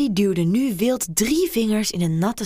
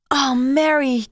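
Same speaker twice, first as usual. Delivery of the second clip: high, loud moan of pleasure; 7 semitones higher, 5 dB louder, slower than usual. A narrator's reading. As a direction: let her name out on a moan. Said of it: "kreunde Dorothy". (Dorothy kreunt hoog en luid Mary's naam)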